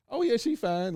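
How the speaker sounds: "Oh, yeah, she fine" with "yeah" jumping way up, leering; suggestive high "yeah". Shown as a demonstration. A dude leering about a woman, "yeah" jumping high. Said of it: "especially if this is the way he said".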